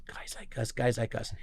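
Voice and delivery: hushed, almost whispered repeat; very soft private echo of someone's words; inner thought. Retold or imitated. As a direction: think it aloud under your breath. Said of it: "and I thought".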